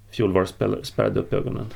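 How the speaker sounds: plain reading voice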